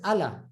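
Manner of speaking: Allah with a short light L; English reading. Shown as a demonstration. Teacher showing how not to say Allah, English reading. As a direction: stress the first syllable of Allah, quick L. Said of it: "that's why English speakers".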